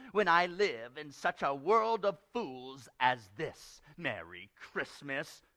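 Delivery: slow, scornful uncle voice; Scrooge. Acted. Uncle scorns a world of fools, slow grumble. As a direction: grumble it out, drawing out each word. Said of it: "returned the uncle".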